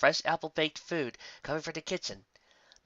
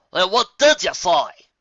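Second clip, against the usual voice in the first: much louder, slow demand; 7 semitones higher, 13 dB louder, slower than usual. Acted. Applejack demands an answer, loud and slow. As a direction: bark it slow and hard.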